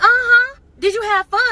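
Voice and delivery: high mock-sweet questioning; sugary fake-nice interrogation; sarcastic sweetness. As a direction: girlfriend grilling boyfriend in mock-sweet tone.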